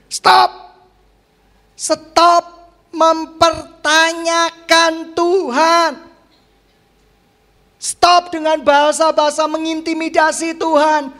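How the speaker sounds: high, loud, drawn-out preacher's command; forceful preaching rebuke. Real, not acted. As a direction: rebuke the congregation from the pulpit.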